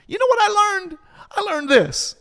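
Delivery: very high, loud excited preaching; high-pitched voice. Real, not acted. Preacher carried away while sharing his lesson, high-pitched. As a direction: pitch shoots way up with fervor. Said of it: "I just went into my high-pitched voice".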